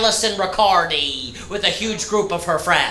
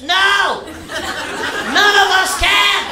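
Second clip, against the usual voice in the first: exasperated yell; 9 semitones higher, slower than usual. Real, not acted. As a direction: shout it back, fed up. Yelling answer to customer.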